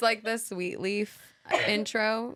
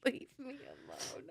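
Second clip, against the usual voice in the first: small, soft mock plea; mock plaintive; 3 semitones higher, 12 dB quieter than usual. Echoing co-host's brush-off, quiet mock plea. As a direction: drop to a soft, pretend whimper.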